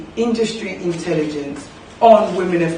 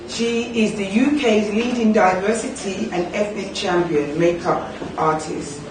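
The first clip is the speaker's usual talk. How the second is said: normal talking voice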